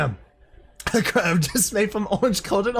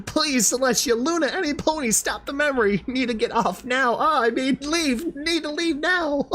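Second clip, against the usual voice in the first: high, pleading mock panic, slowing at the end; mock panicked plea; 8 semitones higher than usual. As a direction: beg like a trapped pony, half joking. Reader begs to escape the scene.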